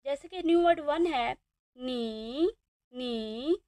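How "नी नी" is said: नी with a dipping third tone. Shown as a demonstration. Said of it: "इसमें आ रही है third tone". (तीसरी tone में नी)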